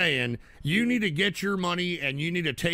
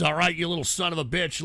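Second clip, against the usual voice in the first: normal talking voice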